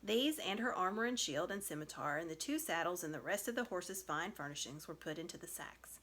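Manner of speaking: usual speaking voice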